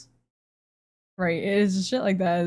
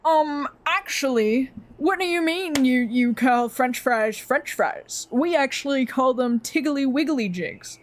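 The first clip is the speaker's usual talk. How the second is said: high, drawn-out pedantic correction; mock a British pedant, starting high and slow; snooty British know-it-all; 3 semitones higher, slower than usual. British person correcting someone on French fries, mock know-it-all. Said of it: "it'll be British people that".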